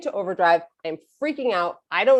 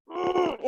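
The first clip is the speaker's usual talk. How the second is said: high, strained irritated hum; mock-annoyed squeal through closed lips; 10 semitones higher, 4 dB quieter than usual. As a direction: squeeze out an exasperated "mm". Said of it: "I'm just so annoyed".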